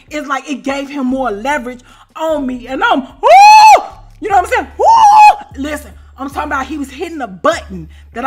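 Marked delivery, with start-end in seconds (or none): 2.7-5.6 high, loud laughing woo, drawn out slowly; ecstatic whoop. Retold or imitated. Reliving his hair whips, a high loud whoop. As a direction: let out a wild shriek.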